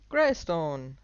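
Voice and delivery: loud call across the hall. Acted. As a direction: hail him from a distance. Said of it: "rief er".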